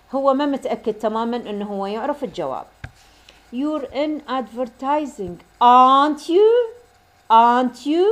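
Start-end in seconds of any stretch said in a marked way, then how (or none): 3.5-6.5 slow statement, then "aren't you" loud and rising; rising tag intonation. Shown as a demonstration. Teacher demonstrating a question tag to students, loud rising "aren't you". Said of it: "شلون النغمة مرتفعة بال question tag". 7.3-8.1 loud "Aren't you" climbing at the end; rising tag intonation. Shown as a demonstration. Teacher repeating the tag for students, loud rising "Aren't you". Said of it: "شلون النغمة مرتفعة بال question tag".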